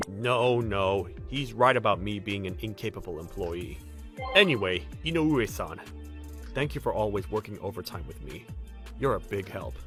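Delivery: humbly grateful, voice dropped; gentle modest coworker. Acted. Modest employee thanking a coworker for overtime help, gentle.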